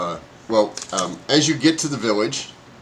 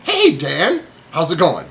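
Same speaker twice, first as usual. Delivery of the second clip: friendly hello; 5 semitones higher, 4 dB louder than usual. Acted. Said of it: "He's like".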